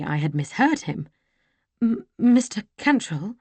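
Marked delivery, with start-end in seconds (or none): 1.8-3.4 halting stammer on the first sound, higher. Acted. Stunned lady stammering his name as the truth dawns. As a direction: trip over his name in shock. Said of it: "I stammered".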